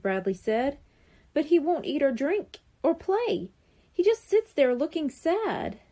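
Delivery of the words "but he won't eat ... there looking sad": put-on higher boy's voice, pleading; a boy worried about his sad rabbit; worried kid. A narrator's reading. Kid tells Mister Neder the rabbit won't eat, higher worried boy voice.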